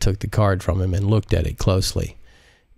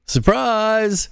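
much higher, gleeful "Surprise!"; surprise greeting; 13 semitones higher than usual. Acted. Husband popping out of the jet, calling out gleefully. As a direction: burst out with the surprise.

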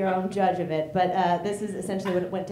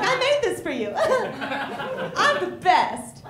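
proud cat; 9 semitones higher, 4 dB louder, much slower than usual. Acted.

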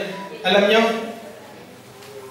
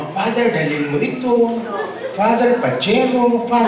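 mock pleading; 7 semitones higher than usual. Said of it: "Kung ano mga".

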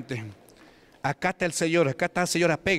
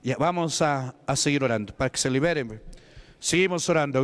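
usual speaking voice

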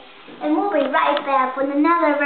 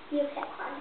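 whispering; 10 dB quieter, faster than usual. Real, not acted. Secret pick before the blindfold.

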